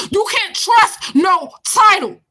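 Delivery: slow, loud shout. Real, not acted. Telling viewers no title can be trusted, shouting slowly. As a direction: hammer it home, shouting.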